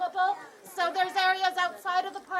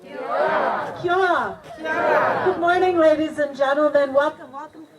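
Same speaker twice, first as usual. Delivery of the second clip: loud calling out to a crowd; big outdoor shout of welcome; yelling greeting; 3 semitones lower, 10 dB louder than usual. Real, not acted. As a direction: belt the welcome to the back row. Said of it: "Welcome to me yelling".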